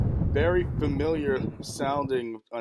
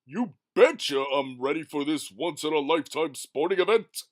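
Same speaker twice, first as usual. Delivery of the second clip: booming showman announcer; race announcer; 5 dB louder than usual. Acted. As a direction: announce it to the crowd.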